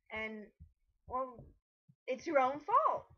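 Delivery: ordinary delivery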